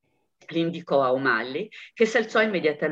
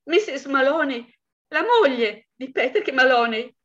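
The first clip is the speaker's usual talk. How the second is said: distraught wife; 9 semitones higher, 3 dB louder than usual. Acted. Wife answers police on phone.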